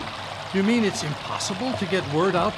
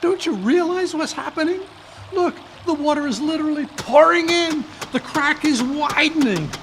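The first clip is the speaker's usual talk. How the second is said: alarmed; 5 semitones higher than usual. Acted. Watson pleading with calm Holmes as water floods in, alarmed. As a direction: urge him, the water is coming in fast.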